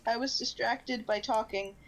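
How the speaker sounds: ordinary delivery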